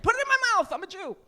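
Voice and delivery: high, loud comic plea; scared yell. Acted. Comic scared of pregnancy, acting a panicked plea. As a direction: plead in hammed-up panic. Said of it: "I'm scared".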